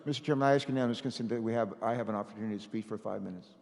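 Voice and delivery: muffled, as if behind a hand. Real, not acted. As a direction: speak through a covered mouth. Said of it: "I can't understand the muffled".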